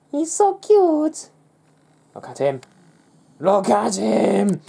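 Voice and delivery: high, slow coo over a sleeping dog; doting dog-owner coo, high and slow. Real, not acted. Cooing over his sleeping dog.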